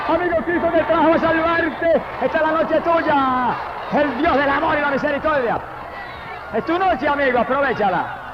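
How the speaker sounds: very high, sustained pulpit cry; fervent altar call, pitched high; preaching at full force. Real, not acted. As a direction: call out to the unsaved in long, high phrases.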